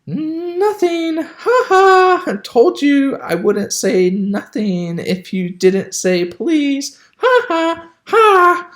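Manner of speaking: high, loud gloating laugh; cackling poltergeist. A narrator's reading. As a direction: cackle triumphantly at the fool. Peeves cackles at Filch.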